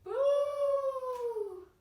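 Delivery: high, loud, drawn-out ghost wail; long pretend spooky hoot; ghost boo. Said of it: "And ghosts say".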